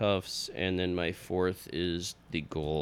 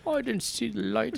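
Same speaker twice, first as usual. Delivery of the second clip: Bane voice. Acted. Continuing Bane quote.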